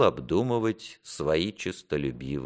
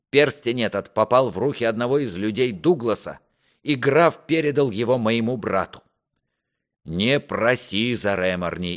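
higher, emphatic royal rebuke; stern, accusing king; 6 semitones higher, 4 dB louder than usual. Acted. King confronts his lying son with the ring, accusing. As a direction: lay out the charge with rising indignation.

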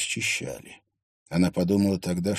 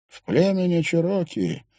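lightly voiced hoarse old man, pitched up; 6 semitones higher than usual. A narrator's reading. Old man explaining the feather at the grave, lightly hoarse. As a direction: explain it with a touch of old man's rasp. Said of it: "сказал он глубоким, хриплым голосом".

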